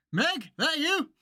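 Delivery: lightly voiced old dad; a hint of an old man calling out. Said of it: "[name]'s old man voice".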